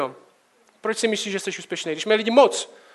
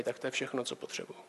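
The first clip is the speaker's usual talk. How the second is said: drops to a near whisper, much softer; hushed confession; 6 semitones lower, 15 dB quieter than usual. Real, not acted. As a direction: let it fade into a breathy admission. Longing for father's approval.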